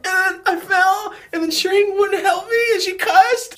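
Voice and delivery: high, loud hammed-up crying kid; mock blubbering, words stretched into a wail; Ralphie-style wailing. Hurt child tattling to mom, mock wailing. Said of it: "That's how I was talking".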